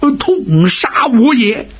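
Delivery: storyteller's cry of agony, slow and high; pained cry. A narrator's reading. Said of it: "在床上大叫一声".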